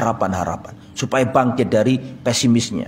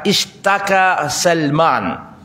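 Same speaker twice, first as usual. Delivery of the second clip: very slow, stretched-out "Ishtaka Salman"; slow Arabic recitation; much slower than usual. Shown as a demonstration. Preacher reading the Arabic slowly before translating it. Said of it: "Ishtaka itu, apa, sakit maksudnya".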